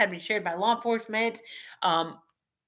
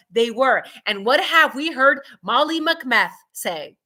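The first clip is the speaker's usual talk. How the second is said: usual speaking voice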